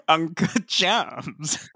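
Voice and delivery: slow, laughing drawn-out fry; laughing vocal fry. Retold or imitated. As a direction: echo the lazy drawl through laughter. Co-host repeats the imitation.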